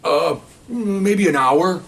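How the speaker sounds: high, very slow vague answer; hammed-up shifty excuse; evasive. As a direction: stall as if unsure. Suspect gives vague answer.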